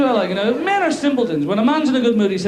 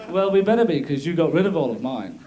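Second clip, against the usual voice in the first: ordinary talk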